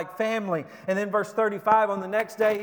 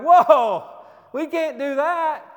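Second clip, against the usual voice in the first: shocked whoa; 7 semitones higher, 8 dB louder, slower than usual. Retold or imitated. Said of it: "And they're like".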